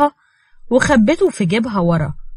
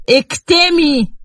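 menacing order; 7 semitones higher, 3 dB louder, much slower than usual. Acted. Selim threatening Nour.

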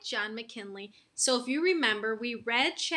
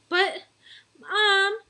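higher, louder whiny plea; pleading; 9 semitones higher, 7 dB louder than usual. A narrator's reading. Boy pleads with mom against early bedtime, pleading. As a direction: beg your mom to let you off. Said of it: "I started to plead".

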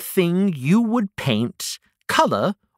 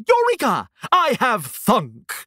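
triumphant eureka; 6 semitones higher than usual. Acted. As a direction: burst out with the big idea. Character has a sudden idea.

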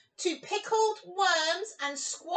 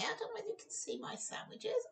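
whisper; 5 semitones higher, 12 dB quieter, much faster than usual. Real, not acted. Aside to camera about sandwiches.

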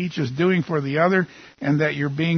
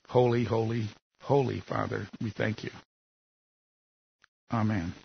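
prayer. Acted. Opening prayer before the sermon.

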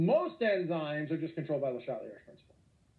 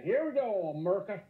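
ordinary delivery

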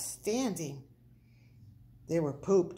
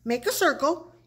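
high, loud, urgent commands; rally everyone for the catch; yelled orders; 6 semitones higher, 5 dB louder than usual. A narrator's reading. Pip readies prairie dogs to catch Big Bark, yelling. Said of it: "yelled Pip".

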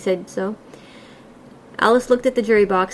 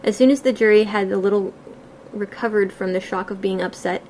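usual speaking voice

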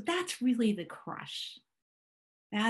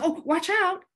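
high, loud warning of fear; mock alarm; 10 semitones higher, 8 dB louder than usual. Fear pattern warning the self, high mock alarm. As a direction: call out a sudden alarmed warning. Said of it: "So that's a fear".

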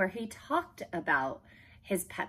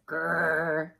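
rolled, growling "grrr"; imitated dog's growl; 4 dB louder than usual. A narrator's reading. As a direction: growl like an unfriendly dog. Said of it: "growled, growled Perky".

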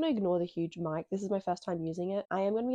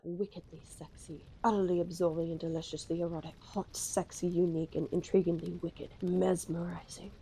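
sultry blurb read; 3 semitones lower than usual. Pretend. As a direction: purr the blurbs like a come-on. Reading sultry blurbs off back.

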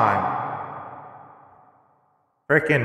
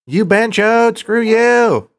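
high, loud mocking shout; shouting insults; 12 semitones higher, 6 dB louder, slower than usual. Real, not acted. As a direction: yell the insults, pitched way up. Cursing at the recorded greeting.

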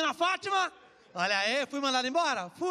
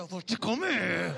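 low, slow growled promise; hammed-up low slow macho line; mock macho voice; 7 semitones lower, slower than usual. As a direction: play the macho lover, deep and slow.

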